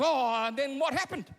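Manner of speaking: a hint of an anxious father; prompting father. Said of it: "And Mike goes".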